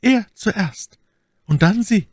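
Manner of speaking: high-pitched threat in a lady's voice; menacing Milady. A narrator's reading. Milady threatening Athos after his friend, high vengeful voice. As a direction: pronounce the threat high and forcefully.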